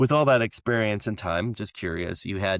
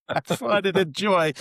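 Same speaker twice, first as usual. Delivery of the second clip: higher, sing-song goodbye; mock farewell; 11 semitones higher, 4 dB louder than usual. Teasing friends who leave the country, higher and sing-song. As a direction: wave them off teasingly.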